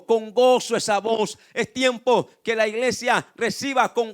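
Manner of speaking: loud, driving pulpit delivery; fervent preacher punching the key words; impassioned preaching. Real, not acted. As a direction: pile phrase on phrase with full force. Preaching at full force.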